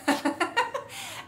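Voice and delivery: high, airy mocking laugh; evil giggle. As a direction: gloat over his horror with a giggle. Villain laughs at his shock.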